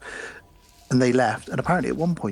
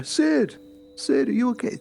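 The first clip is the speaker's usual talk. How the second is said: much higher, anxious call of her name; worried boyfriend calling; 12 semitones higher than usual. Retold or imitated. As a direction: boyfriend rushing over, voice up high. Boyfriend running over calling.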